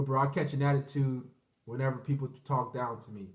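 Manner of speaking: ordinary talk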